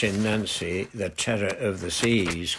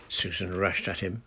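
ordinary delivery

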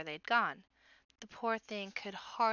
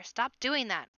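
raised, louder outburst; pained protest; 4 semitones higher, 7 dB louder than usual. A narrator's reading. Wounded brother begging his sister to stop, crying out. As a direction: cry out for it to stop.

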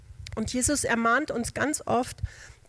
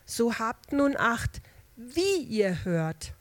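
slow solemn reading; much slower than usual. Shown as a demonstration. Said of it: "In Vers achtzehn sagt er".

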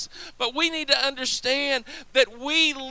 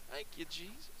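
hushed praise; 8 semitones lower, 20 dB quieter, faster than usual. Real, not acted. Preacher's soft aside of praise.

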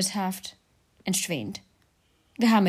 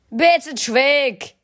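Clint voice; 6 semitones higher, 6 dB louder, slower than usual. A narrator's reading. Clint arguing.

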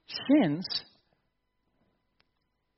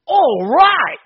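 high, loud delighted cry of All right; thrilled shout; 11 semitones higher, 11 dB louder than usual. Retold or imitated. Adam seeing Eve for the first time, thrilled high shout. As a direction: shout it like a man who can't believe his luck. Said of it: "A more literal rendering of the original Hebrew is".

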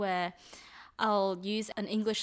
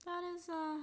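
higher-pitched awe that comes across sarcastic; sarcastic-sounding amazement; 5 semitones higher than usual. Real, not acted. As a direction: marvel as if mocking, though you mean it. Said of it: "I know I might sound sarcastic".

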